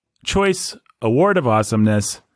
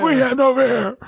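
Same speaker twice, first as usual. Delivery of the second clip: Amazon war cry. Acted. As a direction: call it out like a warrior. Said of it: "And then they all say".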